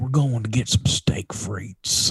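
slow soft whisper. Real, not acted. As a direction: whisper it slowly. Whispered dinner plans.

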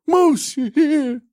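high, loud fawning henchman; eager falsetto lackey; put-on sidekick voice. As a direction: fuss over the boss in a squeaky put-on voice. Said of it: "You gotta talk like this now".

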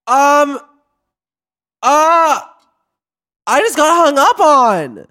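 high, loud, indignant shock; outraged at being hung up on; excited. Real, not acted. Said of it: "Why do I feel excited?".

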